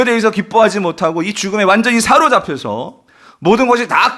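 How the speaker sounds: high, loud, driving sermon delivery; full-force preaching. Real, not acted. Preacher on Jesus' wrath at death's power, full-force preaching. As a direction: build to an impassioned, sustained cry from the pulpit.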